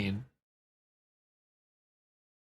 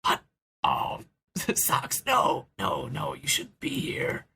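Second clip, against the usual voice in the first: stammering, half-whispered alarm; hushed panic, stumbling over the words; stunned; 7 semitones higher than usual. Acted. Knack finding Socks here, stunned hushed protest. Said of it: "he's stunned for a moment".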